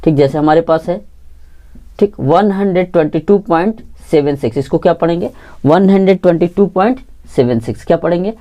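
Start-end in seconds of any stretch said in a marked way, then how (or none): 2.2-4.6 सेवन सिक्स as two single digits after पॉइंट; digit-by-digit reading. Shown as a demonstration. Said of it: "एक एक करके पढ़ते हैं". 5.6-7.8 सेवन सिक्स as two single digits after पॉइंट; digit-by-digit reading. Shown as a demonstration. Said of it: "एक एक करके पढ़ते हैं".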